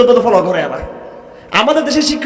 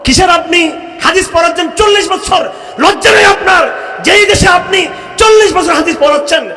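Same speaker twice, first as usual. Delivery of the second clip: angry rebuke; 7 semitones higher than usual. Real, not acted. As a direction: berate them with outrage. Preacher rebukes hadith teachers.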